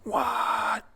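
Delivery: loud, high, drawn-out shout of disbelief; group yell. A narrator's reading. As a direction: yell it out like a whole crowd of kids. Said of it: "all of them yell out".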